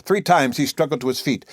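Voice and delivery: plain reading voice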